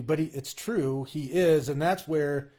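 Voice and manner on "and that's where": normal talking voice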